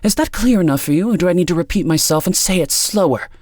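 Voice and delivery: high, loud condescending demand; impatient talking-down. A narrator's reading. Impatient woman talks down to Amanda.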